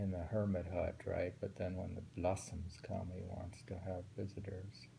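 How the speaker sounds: usual speaking voice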